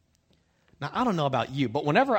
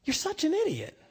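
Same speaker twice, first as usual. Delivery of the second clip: Peter scolding Steve; 5 semitones higher, slower than usual. Retold or imitated. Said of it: "being like".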